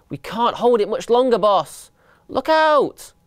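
high strained call rising to a cry; alarmed warning. A narrator's reading. Mice losing hold of the fridge to their boss, alarmed warning. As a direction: build to a panicked warning.

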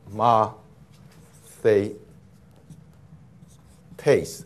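吗, 啡, taste as separate syllables with long gaps. Shown as a demonstration. Teacher splits a word for students, syllable by syllable. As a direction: break 吗啡taste into three slow, separate beats. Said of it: "在什么音节啊".

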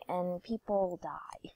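hushed, slow dramatic whisper. Pretend. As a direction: breathe it out like a dark secret. Dramatic aside about killing online.

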